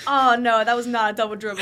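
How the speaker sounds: loud mock protest; big, jokey sideline objection; teasing the ref. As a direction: heckle the referee in fun. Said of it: "teased the refs".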